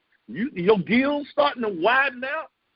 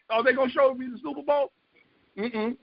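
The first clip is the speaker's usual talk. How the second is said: ordinary talk, nothing marked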